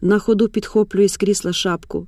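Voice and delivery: ordinary delivery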